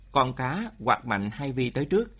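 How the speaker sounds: plain reading voice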